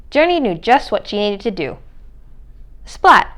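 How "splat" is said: plain reading voice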